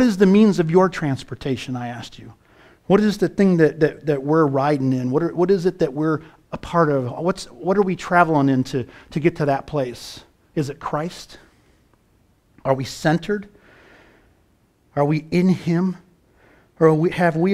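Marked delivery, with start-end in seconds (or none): none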